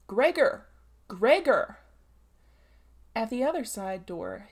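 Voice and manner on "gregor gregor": loud warning shout of a name; growled warning. A narrator's reading. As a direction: bellow a stern warning through the door. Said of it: "with a warning deepness in his voice".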